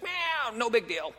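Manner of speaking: squeaky high meow, then a shrugging no big deal; a light mock of not caring; mock dismissive shrug. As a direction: brush it off like a bored cat. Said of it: "be like".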